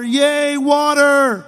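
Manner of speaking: high, loud cheer for the crowd; playful shouted celebration; mock cheering. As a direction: whoop it like a delighted crowd. Crowd cheering at abundant water.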